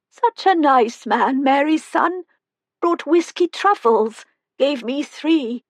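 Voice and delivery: put-on frail old woman. A narrator's reading. Old Helen tells the visitors about whiskey truffles, high and sly. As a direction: play her as a mischievous old resident.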